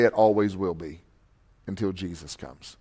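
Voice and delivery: usual speaking voice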